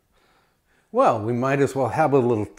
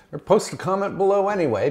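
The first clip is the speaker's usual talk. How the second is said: higher, fast cheeky aside; playful; 9 semitones higher, much faster than usual. Real, not acted. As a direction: tease them into commenting. Playful repeat of the request.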